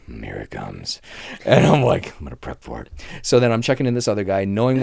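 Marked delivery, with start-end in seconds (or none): 0.1-1.0 very low, quiet mutter; ominous aside. Retold or imitated. Front desk agent sensing trouble coming, low wary mutter. 2.2-2.9 low, very soft self-talk; muttered aside. Retold or imitated. Front desk agent prepping for trouble, quiet low mutter.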